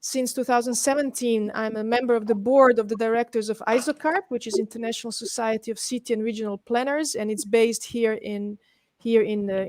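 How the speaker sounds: usual speaking voice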